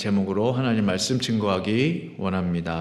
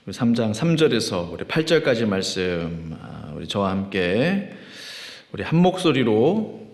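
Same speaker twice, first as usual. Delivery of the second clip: ordinary talk, nothing marked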